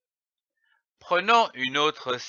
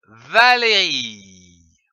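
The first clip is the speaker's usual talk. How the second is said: slow blended reading; 5 semitones lower, 4 dB louder, slower than usual. Shown as a demonstration. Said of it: "Commençons par lire les syllabes".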